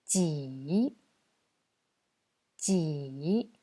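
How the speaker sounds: "Ji" dipping low, then rising; third tone. Shown as a demonstration. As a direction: drop "Ji" low and lift the end. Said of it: "troisième ton".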